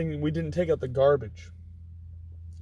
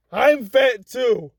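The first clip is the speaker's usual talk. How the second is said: drill sergeant; 4 semitones higher, 4 dB louder than usual. Retold or imitated. Sergeant voiced in story.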